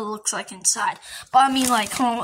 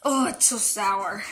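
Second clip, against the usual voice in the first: ordinary talk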